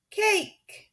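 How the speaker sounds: put-on high baby shriek of cake. Sunny shrieking for cake as everyone laughs. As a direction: squeal it like a toddler. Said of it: "Sunny shrieked again".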